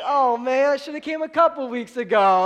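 high, loud comic groaning complaint; mock dismay. First-time guest hearing the sermon is on fasting, mock dismay. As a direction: play up a newcomer's regret.

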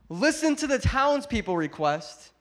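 high, loud, emphatic sermon delivery; loud preaching. Acted. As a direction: hammer the point home to the congregation. Preacher's second loud climax.

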